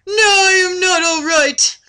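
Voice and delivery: high, loud mock-tearful wail; hammed-up sobbing. Reading Laura's reply to a friend, mock-tearful and high. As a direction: fake a weepy damsel.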